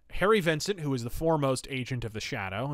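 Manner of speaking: ordinary delivery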